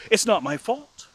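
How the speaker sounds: high-voiced brother defending himself; imitating a defensive sibling; defensive protest.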